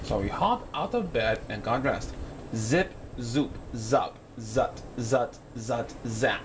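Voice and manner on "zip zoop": usual speaking voice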